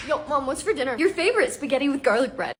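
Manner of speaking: ordinary delivery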